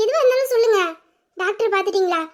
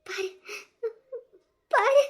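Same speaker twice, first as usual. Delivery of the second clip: tearful urging; 3 semitones higher, 5 dB quieter, much slower than usual. Acted. Weeping, urges them to look.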